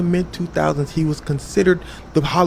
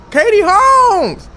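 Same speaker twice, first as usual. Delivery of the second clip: high, loud, very slow name call; announcer's holler; 12 semitones higher, 8 dB louder, much slower than usual. Retold or imitated. Oprah calls for missing Katie Holmes, drawn-out holler. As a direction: bellow the name, stretching it out.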